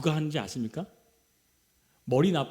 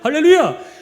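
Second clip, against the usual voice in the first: shouted hallelujah; 9 semitones higher, 13 dB louder than usual. Real, not acted. Preacher's climactic shout.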